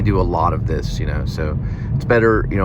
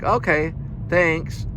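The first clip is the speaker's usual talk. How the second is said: high cheerful worker; 9 semitones higher than usual. Retold or imitated. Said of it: "she's like".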